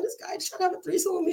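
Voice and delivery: high, hurried upset retelling; imitated tearful complaint. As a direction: blurt it out to your sister, mock-crying. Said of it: "I'm crying like a little bitch".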